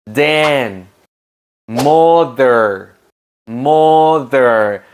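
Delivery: "Together", "Father", "Mother" with a buzzing voiced TH; slow, careful voiced TH on every word; voiced th drill. Shown as a demonstration. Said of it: "los sonidos con voz".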